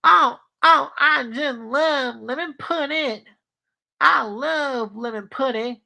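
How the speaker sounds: hammed-up southern twang, long wobbly vowels up high; Stinky. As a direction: gush in a cartoon country boy's southern accent. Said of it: "favorite food is lemon pudding".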